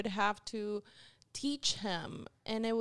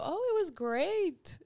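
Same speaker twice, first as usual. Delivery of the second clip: mock enthusiasm; 9 semitones higher, 6 dB louder than usual. Ex-husband's cheery report after kayaking.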